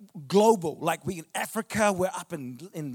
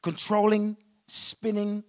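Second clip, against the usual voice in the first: full-force preaching; 3 semitones higher, 7 dB louder than usual. Acted. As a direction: proclaim it to the whole room.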